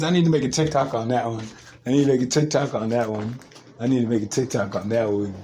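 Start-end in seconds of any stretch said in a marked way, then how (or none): none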